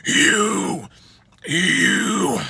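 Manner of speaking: raspy low-register scream. Shown as a demonstration. Coach showing own low register, low scream. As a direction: growl the word as a scream. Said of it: "my low would be".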